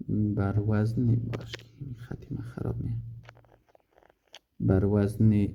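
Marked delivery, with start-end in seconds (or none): 1.1-3.0 whispered aside. Real, not acted. Aside while writing on board.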